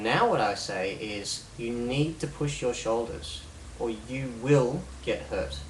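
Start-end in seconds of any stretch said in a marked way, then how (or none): 1.6-5.6 slow command, leaning on "need" and "will"; firm command. Shown as a demonstration. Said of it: "Can you hear the words".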